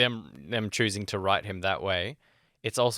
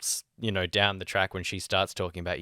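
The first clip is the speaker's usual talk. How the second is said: ordinary talk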